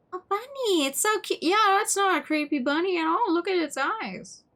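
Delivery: very high-pitched cooing squeal; high, delighted gushing; gushing over cuteness. Real, not acted. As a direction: squeal with delight at a cute animal. Gushes over the creepy bunny.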